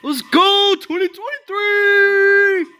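high shouted cheer; excited cheer. Real, not acted. Celebratory cheer.

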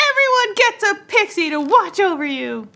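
high, bright fairy leader. Acted. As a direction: cheerfully promise a pixie each. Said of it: "And Skyberry's like".

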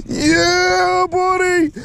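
very high, drawn-out cheer; real victory cry; elated. Real, not acted. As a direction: crow it in triumph, voice soaring. Celebrating the catch.

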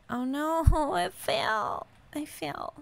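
odd high voice. Real, not acted. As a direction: squeak it out in a silly high pitch. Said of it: "I don't know why I said it like that".

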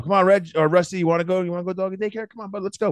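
high, excited voice hyping up the dog; pumping up the dog. Retold or imitated. Owner gets his dog excited for daycare, high and eager. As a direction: sell doggy daycare to the dog like it's the best thing ever. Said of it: "So I get 'em all pumped up".

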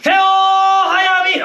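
high, very slow, loud bellowed line; bellowing the poem to startle the barbershop customers; sudden loud shout. Acted. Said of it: "なんだ、大きな大きな".

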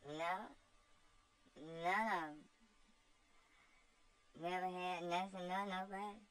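put-on cheeky girl shrugging off blame; voiced slave girl Topsy. Topsy answers her master with cheeky girlish voice.

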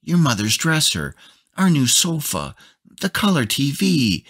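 high, fast, loud upset listing of losses; wife fretting over the furniture, high and upset; upset scolding. A narrator's reading. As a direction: wail out each ruined thing in dismay. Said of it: "she said in an upset, scolding voice".

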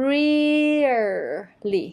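"really" with a high, long REE, then a falling "lee"; exaggerated first-syllable stress. Shown as a demonstration. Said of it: "เน้นเสียงหนักที่พยางค์แรก".

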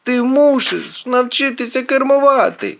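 high, insistent demand; fed-up shout at a bad driver; yelling. A narrator's reading. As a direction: yell it as an exasperated order. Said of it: "волає Квінт".

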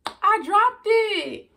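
loud, high, drawn-out wail of dismay; dismayed cry. Real, not acted. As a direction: cry out slowly after dropping your snack. Gasps after dropping the Jell-O.

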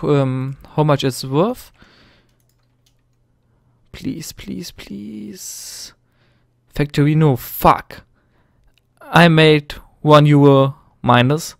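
usual speaking voice

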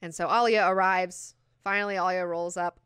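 ordinary talk

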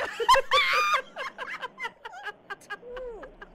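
loud, high-pitched shrieking laugh; piercing cackle of real amusement; helpless laughter. Real, not acted. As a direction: burst out in a long, high laugh. Reaction to a silly joke.

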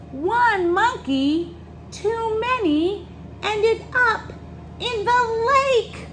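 read-aloud soaring high at the climax; dramatic story reading. A narrator's reading. Reader tells children of cart crash, dramatic storytelling. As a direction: build alarm, then stretch out the finish.

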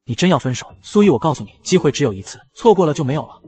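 plain reading voice

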